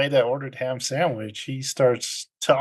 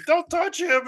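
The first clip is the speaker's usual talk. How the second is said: high-pitched protective outburst, retold; sudden high mock cry to back off; mock warning. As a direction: act out a fired-up warning. Fred steps into the fight.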